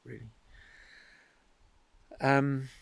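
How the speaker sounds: ordinary talk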